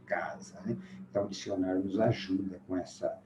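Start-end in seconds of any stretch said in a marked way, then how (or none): 0.0-0.6 "casa" com K inicial, mais baixo e grave; pronúncia correta. Shown as a demonstration. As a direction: reafirme "casa" como CÁ-za. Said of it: "é casa". ("casa" com som de K e Z, tônica na primeira)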